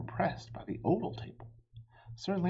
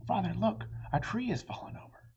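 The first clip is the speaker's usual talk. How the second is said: shouted; 7 semitones higher, faster than usual. A narrator's reading. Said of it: "until Radius shouted".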